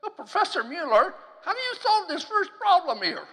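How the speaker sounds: high-pitched mock student asking the professor; imitated student. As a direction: put on a loud, high student voice. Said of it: "Guy says".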